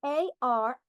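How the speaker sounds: teacher names the letters A R slowly; letters named slowly. Shown as a demonstration. Teacher spelling for the class, letters named slowly. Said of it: "R as in car".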